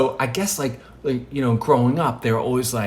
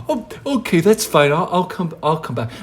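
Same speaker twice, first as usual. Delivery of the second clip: high, loud start easing down; hurriedly apologetic, voice jumping up; acted embarrassed retreat; flustered backing off; 5 semitones higher, 4 dB louder than usual. Jesus backs off from busy Peter.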